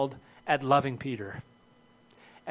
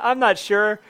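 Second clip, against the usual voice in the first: hesitant Peter; 6 semitones higher, 8 dB louder, slower than usual. Retold or imitated. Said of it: "Not even like".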